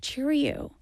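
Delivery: Cheerio in a flat American accent; mock-American Cheerio. Shown as a demonstration. Host demos an American Cheerio, American accent. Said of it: "you can't say it in an American accent".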